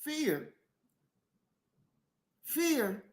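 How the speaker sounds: very soft, isolated word with long pauses; hushed emphasis. Real, not acted. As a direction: let the single word hang quietly. Dramatic hushed pause on key word.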